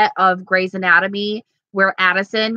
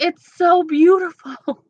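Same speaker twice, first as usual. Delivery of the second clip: swooning gush; 9 semitones higher than usual. Retold or imitated. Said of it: "I was like".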